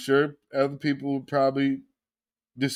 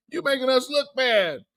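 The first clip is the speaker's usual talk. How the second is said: mock quote; 11 semitones higher than usual. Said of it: "Now that's funny".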